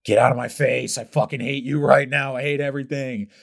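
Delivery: exhausted snap. Retold or imitated. Murphy snapping at Sam during the brutal workout, irritated. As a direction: shove him off with your voice.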